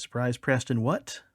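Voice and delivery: usual speaking voice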